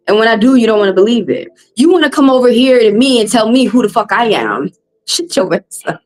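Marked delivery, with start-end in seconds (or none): none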